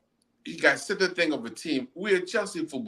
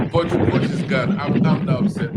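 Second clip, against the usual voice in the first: laughing; 8 dB louder than usual. Real, not acted. Laughing, louder denial.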